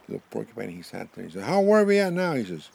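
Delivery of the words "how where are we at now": high, loud storybook call. Acted. Swallowed porcupine asks buffalo how far, calling out. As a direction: call up a question from inside.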